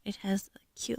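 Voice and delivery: whispered to the viewer. Real, not acted. Describing the doll's shirt to viewers, a whisper. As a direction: breathe it out softly, no voice.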